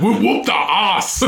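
high, put-on Schwarzenegger-ish voice; bad action-hero accent, laughing through it; attempting Australian, landing on Arnold; mock Austrian accent. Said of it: "I can't do an Australian accent".

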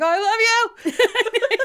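high, loud, drawn-out swooning cry; hammed-up smitten gush, laughing; mock lovestruck outburst. Imitating Ron under the girls' charm, loud gushing. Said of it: "he's like".

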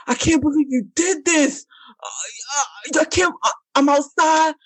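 high, mock-sobbing pleading; hammed-up distraught boyfriend; whiny boyfriend. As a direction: ham up his desperate panic. Mocking boyfriend's desperate messages.

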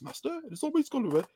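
high, thin servile droid voice; mimicking a fussy droid butler. Teacher imitates the servile droid from Star Wars, high and fussy. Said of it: "the droid that speaks like this".

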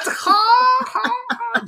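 high, loud mock cackle; mock laughing. Host mimics the teens cracking up over the accent, high mock laughter. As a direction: ham up the students' laughter.

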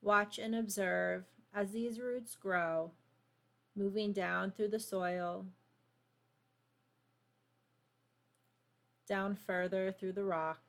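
calm, unhurried meditation voice; guided meditation. Acted. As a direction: guide them gently into stillness. Leads a grounding meditation.

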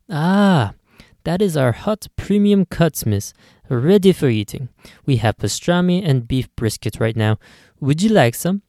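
showing off the hot cuts in a light Italian lilt; Italian clerk accent. Acted.

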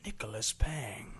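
low, slow trailer announcer; movie trailer voice. Pretend. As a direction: intone it like a trailer. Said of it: "nineties movie trailer voice".

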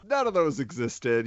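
normal talking voice

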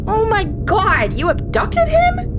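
very high, loud disbelief; shocked shriek. Acted. Alien abducted host.

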